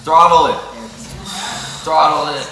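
loud urging. Real, not acted. Urging his friend to eat.

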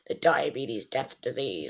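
low, slow announcer of doom; mock-ominous low narration, drawn out; deep doom voice. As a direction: intone it like a grim movie trailer. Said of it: "a deep voice going like".

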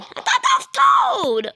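very high cartoon voice; squeaky character voice.

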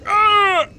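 loud high startled shout; startled yell. Real, not acted. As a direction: yelp at the sudden jolt. Sudden boost sends him flying.

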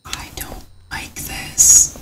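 slow unvoiced whisper, hushed and tense; scared whisper. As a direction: whisper it as if something could hear you. Scared alone in the dark.

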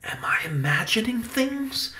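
whispered, inward question; wondering thought. A narrator's reading. Benny doubts what the photo showed, whispered wondering. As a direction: think it aloud under the breath. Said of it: "Benny thought".

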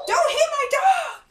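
very high, loud shriek of protest; outraged yell. Real, not acted. Player defending the dog from Shane, outraged. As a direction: scream it in protest. Quarrel over the dog being hit.

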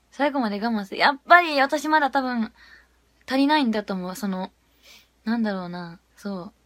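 ordinary talk

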